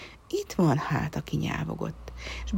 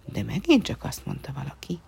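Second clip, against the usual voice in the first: ordinary delivery, nothing marked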